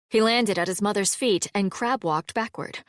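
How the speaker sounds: ordinary delivery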